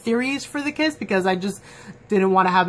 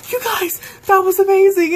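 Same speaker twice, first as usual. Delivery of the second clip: much higher and louder, bursting with excitement; excited Simon; 11 semitones higher, 7 dB louder than usual. Retold or imitated. Simon cheering the couple after their kiss, excited. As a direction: gush it like an overexcited friend. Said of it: "and Simon's like".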